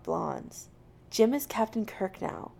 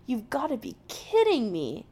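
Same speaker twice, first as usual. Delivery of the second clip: higher-pitched exasperated groan; groaned exasperation; 4 semitones higher than usual. A narrator's reading. Jim to McCoy over the captain thing, groaning. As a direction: groan it in disbelief. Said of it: "Jim groans".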